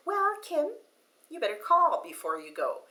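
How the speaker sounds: high-pitched on the name, then easing down; a mock nagging voice in the head; insistent inner voice. Little voice in her head warns her to call first, insistent. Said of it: "speaks louder than the others and says".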